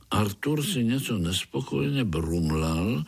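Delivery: plain reading voice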